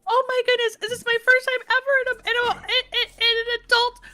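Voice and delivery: put-on high, fast, breathless little girl voice; excited little girl. Granddaughter to grandma about the car seat, high excited child voice. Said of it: "so she goes".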